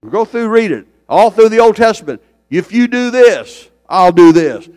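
loud, high-pitched preaching climb; rising revival preacher cry; full-force preaching. Acted. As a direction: preach it out to the back row. Preacher raising voice on scripture.

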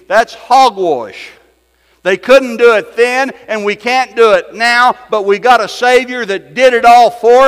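loud, high, driving sermon voice; preaching at full force. Acted. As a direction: preach it hard to the congregation.

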